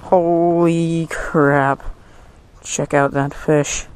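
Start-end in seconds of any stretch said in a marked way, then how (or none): none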